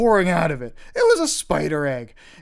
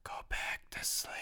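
eerie breathy whisper; ghostly whisper; 17 dB quieter than usual. Retold or imitated. Ghost in the closet telling the boy to sleep, whispered. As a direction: whisper it like an unseen presence. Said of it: "a voice I'd never heard before".

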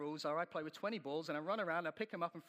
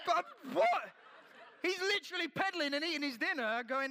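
much higher, louder laughing disbelief; incredulous laugh; 11 semitones higher, 7 dB louder than usual. Real, not acted. [name] learning Chris is eating dinner, laughing disbelief. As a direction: squeal in disbelief through a laugh.

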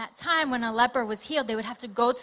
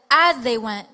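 "As they went" punched out much louder; emphatic stress; 11 dB louder than usual. Real, not acted. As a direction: hit "As they went" hard as the key point. Preacher stresses key scripture phrase.

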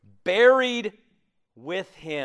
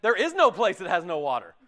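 ordinary talk, nothing marked

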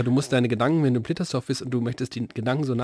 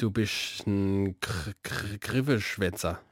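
slow, softer, halting Badisch; Badisch dialect; 4 semitones lower, 5 dB quieter, much slower than usual. Shown as a demonstration. Co-host answering how it is said in Badisch, in halting dialect. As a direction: feel for the Badisch word slowly. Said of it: "was sowas auf Badisch heißt".

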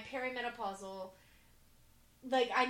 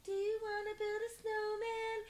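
high, sweet plea through the door; sing-song little sister; 8 semitones higher than usual. Retold or imitated. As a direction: coax like a lonely little girl.

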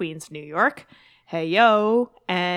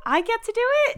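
excited; faster than usual. Real, not acted. Excited to introduce podcast.

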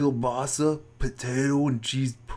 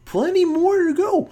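excited high; 5 dB louder than usual. Real, not acted. Excited interjection, much higher.

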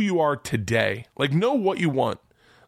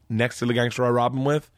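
normal talking voice

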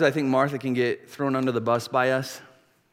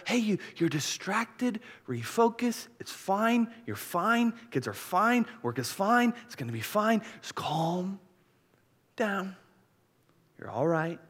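soft, higher-pitched soothing voice in short phrases; loving reassurance; 6 semitones higher, 4 dB quieter, slower than usual. Acted. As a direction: gently talk a worried friend down. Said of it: "this is a loving comment from Jesus".

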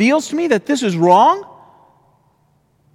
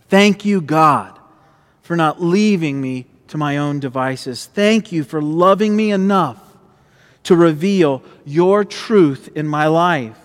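prayer; 6 semitones lower than usual. Acted. Closing prayer to God.

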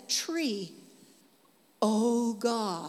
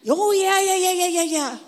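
higher, louder dismissive yeahs; distracted friend on phone; 8 semitones higher, 8 dB louder than usual. Friend Pat on the phone brushing him off, higher and louder. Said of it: "And she's like this".

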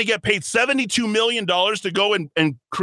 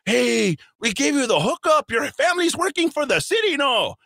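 higher, fast, hammed-up schmoozing pol; mock the smooth party pitch, voice pushed well up; sly mock politician working the crowd; 8 semitones higher than usual.

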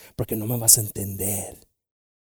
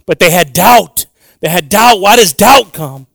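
emphatic preaching; 5 semitones higher, 14 dB louder, slower than usual. Acted. Preacher driving the point.

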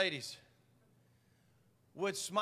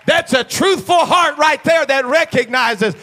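loud, high fervent preaching; full-throated pulpit cry; 8 semitones higher, 19 dB louder, slower than usual. Acted. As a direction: shout it out like a revival preacher.

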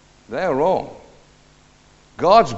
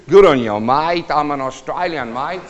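attempted Aussie accent; Australian accent; 5 dB louder than usual. Newcomer to Australia trying out the local talk, mock Australian accent. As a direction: have a playful go at an Australian accent. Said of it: "now I'm in Australia, and I'm trying to do".

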